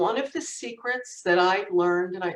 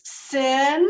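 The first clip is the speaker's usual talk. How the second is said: retold yell; 8 semitones higher, 4 dB louder than usual. Brother at the bathroom door, retold yell. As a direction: call out on a high pitch. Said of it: "and he yells".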